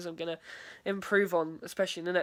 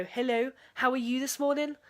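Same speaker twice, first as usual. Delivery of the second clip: Liverpool accent, tune climbing high at the end; Scouse greeting, going up at the end of it; cheery Liverpool hello, pitch rising through the line; 6 semitones higher, 3 dB louder than usual. Shown as a demonstration. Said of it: "in a Liverpool accent, I go".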